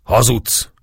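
high, sudden outcry; sudden accusing shout. A narrator's reading. Priest confronting the captive Turk, sudden shout. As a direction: burst out with the accusation. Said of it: "Egyszer csak rákiáltott".

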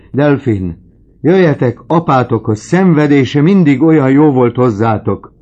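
loud, high, frantic pleading cries; climbing into a cracked frantic yell; desperate shouting father. Acted. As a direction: shout it out as a dying man begging. Dying father raging for daughters.

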